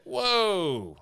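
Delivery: the whole crowd reacting in shock; shocked whoa. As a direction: onlookers stunned by the outburst, high and loud. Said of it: "And everyone was just like".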